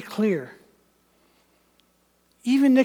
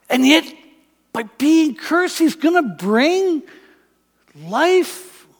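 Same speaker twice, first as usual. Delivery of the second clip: higher, searching questions; puzzled wondering; 4 semitones higher, 4 dB louder than usual. Acted. Nicodemus puzzling over Jesus' claims, higher and bewildered. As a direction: turn it over in disbelief. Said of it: "he's going".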